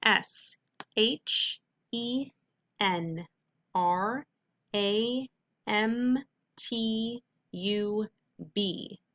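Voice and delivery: slow dictation to students; slow spelling dictation. Shown as a demonstration. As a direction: spell A M T U out slowly, letter by letter. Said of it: "write this fake word".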